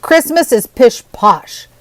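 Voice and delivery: slow, loud gruff scoff; drawn-out grumpy dismissal. Acted. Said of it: "said Jonathan gruffly".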